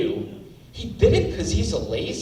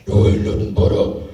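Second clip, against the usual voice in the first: metal growl; 11 semitones lower than usual. Retold or imitated. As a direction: growl it like a death metal singer. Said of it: "couldn't even do a halfway decent metal growl".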